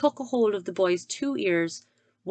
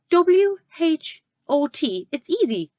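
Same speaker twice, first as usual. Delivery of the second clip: higher, slow spelling; eager boy; 9 semitones higher, 5 dB louder, slower than usual. Acted. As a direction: spell it out proudly like a child.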